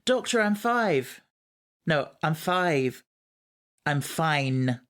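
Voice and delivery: light Scottish accent impression, drawn out slowly; a hint of a Scottish Amy Pond, slowed down. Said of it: "Did you have to do the Scottish accent?".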